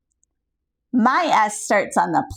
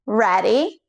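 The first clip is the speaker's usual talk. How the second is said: ordinary talk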